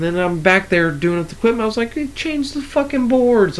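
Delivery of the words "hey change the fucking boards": exasperated order. Retold or imitated. Ordering coworkers to swap boards.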